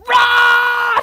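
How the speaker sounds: loud, high shout of the name; mock shouting a friend's name right into his ear. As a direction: blast it straight into his ear. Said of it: "in Rod's ear, we're like".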